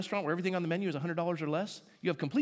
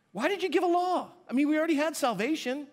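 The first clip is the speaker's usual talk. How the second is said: exasperated question; 9 semitones higher than usual. Retold or imitated.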